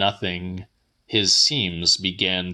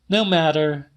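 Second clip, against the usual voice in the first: voiced much higher as the woman; Celia's voice; 8 semitones higher than usual. A narrator's reading. Woman telling the old man her news, higher voice. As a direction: lift the pitch well up for her lines.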